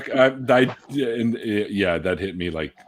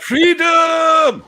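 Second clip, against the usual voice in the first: high, loud Braveheart yell; mock shout; 5 dB louder than usual. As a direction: belt it out, laughing. Echoing the elevator scream gag.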